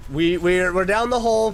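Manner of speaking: normal talking voice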